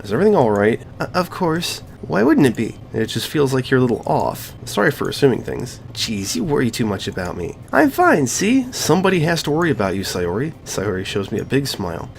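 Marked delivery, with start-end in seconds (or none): 1.0-2.8 higher, put-on girl's voice for Sayori; playing Sayori. Voicing Sayori. 7.7-8.7 higher, put-on girl's voice for Sayori; playing Sayori. Voicing Sayori.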